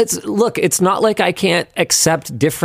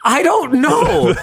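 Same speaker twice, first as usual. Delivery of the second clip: laughing; 9 semitones higher, much slower than usual. Real, not acted. Laughing about dry Reuben.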